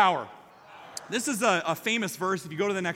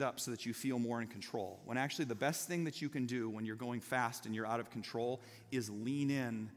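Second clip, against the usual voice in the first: much softer and lower, a patient brother explaining; calm explanation; 6 semitones lower, 10 dB quieter than usual. Retold or imitated. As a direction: settle him down with quiet advice. Brother calmly explains technique.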